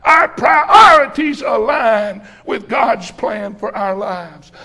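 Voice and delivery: loud, drawn-out sermon cadence; full-force preaching. Acted. Preacher driving his point home to the congregation, loud and slow. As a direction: preach it hard to the church, every word stretched.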